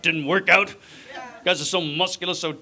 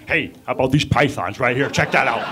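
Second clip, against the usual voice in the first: lower, deliberate tough-guy wrestler boast; Jesse Ventura; 4 semitones lower than usual. Retold or imitated. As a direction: show off your arms like a cocky wrestler. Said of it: "You imagine that?".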